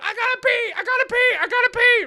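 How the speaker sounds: very high, loud, insistent yelling; imitated kid scream. Nephew drowning out his uncle's pleas, imitated screaming. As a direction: cut in screaming like a frantic child. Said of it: "he's just screaming".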